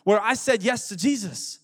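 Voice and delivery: preacher giving an invitation to the congregation; fervent altar call. Acted. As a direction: urge them to respond now. Altar call at full force.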